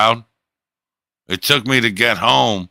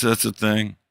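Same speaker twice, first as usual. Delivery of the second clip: normal talking voice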